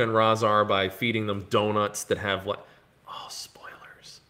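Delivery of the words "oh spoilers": whispered, slow spoiler warning; hushed aside. Pretend. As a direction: whisper it as if letting slip a secret. Stops short of revealing a spoiler.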